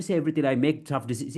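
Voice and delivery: hurried and run together, cut off unfinished; rushed. Shown as a demonstration. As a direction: rush it without any breaks. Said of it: "If I try to rush".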